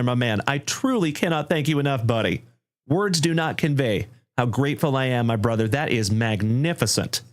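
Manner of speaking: ordinary talk, nothing marked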